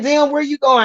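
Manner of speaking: high, startled where you going; mock surprised. Her reaction to him leaving, high mock surprise. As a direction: act taken aback in a high voice. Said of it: "I'm like".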